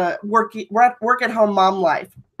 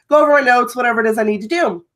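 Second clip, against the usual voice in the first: ordinary talk, nothing marked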